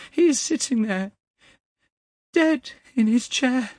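very high, loud, distraught voice; horrified son. A narrator's reading. Young man tells of his dead father, horrified and distraught. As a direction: cry it out in shock.

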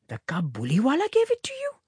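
quick, high rising question of a shocked mother; shocked cry. A narrator's reading. Mother hearing where the coin came from, shocked. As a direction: echo it in shock, pitch shooting up. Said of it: "cried her mother, much shocked".

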